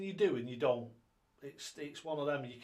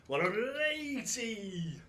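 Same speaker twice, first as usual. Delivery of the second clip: higher, slower caller call; darts caller call; 6 semitones higher, slower than usual. Acted. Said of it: "I could just do it my way".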